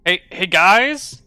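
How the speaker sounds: excited villager calling his friends over. Acted. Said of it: "calls one of the villagers".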